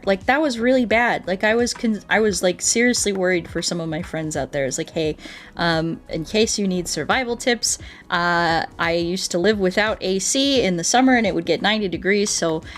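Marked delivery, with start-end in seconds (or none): none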